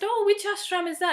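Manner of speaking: curious dentist. Retold or imitated. Dental teacher asks.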